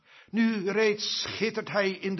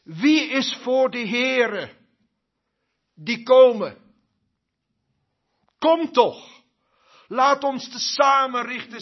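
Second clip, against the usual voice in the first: loud, higher urgent summons; loud call; 4 semitones higher, 9 dB louder than usual. Acted. Moses calls the people to come, loud and urgent. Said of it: "Mozes riep".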